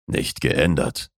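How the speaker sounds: low, slow male character voice; correcting man. A narrator's reading. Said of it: "korrigierte er". (langsam korrigierende, tiefere Männerstimme)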